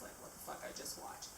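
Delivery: whispered aside under her breath; whispering. Real, not acted. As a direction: drop to a whisper for the swear. Swear dropped as aside.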